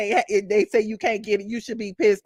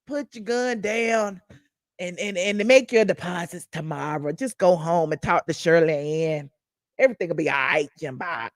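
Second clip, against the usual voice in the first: soothing cop; 6 semitones lower than usual. Retold or imitated. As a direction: talk him down gently like an old friend. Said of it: "Got his hand on the shoulder".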